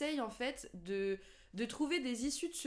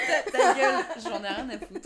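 shouted through laughter; laughing mock insult; 6 semitones higher, 10 dB louder than usual. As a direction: jokingly tell her to shut up. Mock rude retort, laughing.

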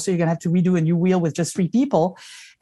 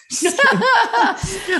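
laughing; 14 semitones higher, much slower than usual. Real, not acted. Teasing joke about never being asked.

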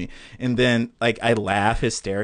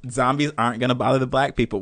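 normal talking voice

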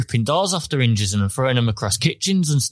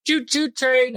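put-on child voice; 10 semitones higher, slower than usual. Quoting toddler Theo.

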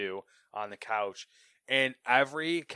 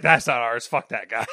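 loud dismissive; 10 dB louder than usual. Retold or imitated. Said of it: "the second team's like".

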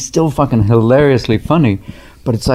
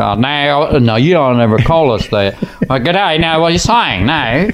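lightly put-on Australian accent, a bit higher; mock Aussie; 5 semitones higher than usual. Robin voicing an offended Australian, light Australian accent. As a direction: wave it off with a light Australian twang. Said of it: "they go".

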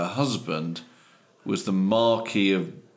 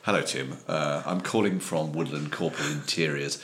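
put-on low, soft posh English accent; quietly well-spoken, posh businessman accent; posher accent; 5 semitones lower, 6 dB quieter than usual. Company owner phoning Tim, soft posh accent. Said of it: "I could do slightly posher than myself".

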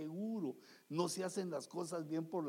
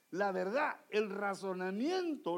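ordinary talk, nothing marked

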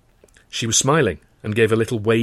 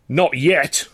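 loud and higher, defiant; roar; 6 semitones higher, 5 dB louder than usual. Acted. Young actor roaring at his sneering boss. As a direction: throw it back at him. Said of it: "I roared".

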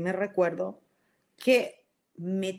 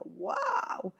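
amazed wow; 14 semitones higher, 4 dB quieter than usual. Retold or imitated. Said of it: "decía".

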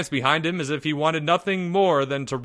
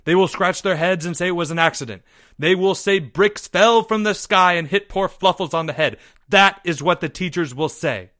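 loud, raised, insistent rant; snapping; 7 semitones higher, 6 dB louder than usual. A narrator's reading. Leo tells the boys what teachers will say, snapping. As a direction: snap it out higher and louder. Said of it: "Leo snapped".

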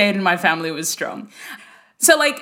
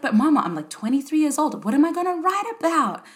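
whiny protest; 8 semitones higher than usual. Retold or imitated. Young daughter protesting to mum.

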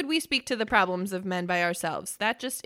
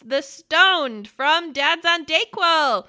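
slow, louder grand announcement; announcer intro; 5 semitones higher, 7 dB louder, slower than usual. Acted. Host introducing the guest to listeners, slow loud announcer voice. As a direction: build it like a ring announcer.

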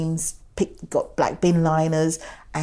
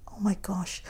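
whispering; 3 semitones higher, 8 dB quieter than usual. Retold or imitated. Awed moment seeing crowd.

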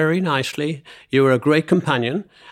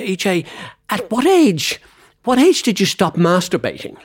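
higher-pitched mock Scottish accent; 5 semitones higher, 3 dB louder than usual. Co-host quoting his friend's cheeky question, mock Scottish accent. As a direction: imitate a Scottish friend putting a blunt question. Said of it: "in your typical Scottish accent".

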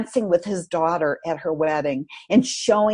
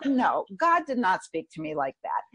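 ordinary delivery

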